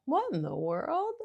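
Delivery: high, curious cartoon "What in the world?"; cartoony surprise. Shown as a demonstration. Mom shows her daughter a silly curious reaction to a noise. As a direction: play it up like a cartoon character. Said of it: "I will do kind of a cartoony thing".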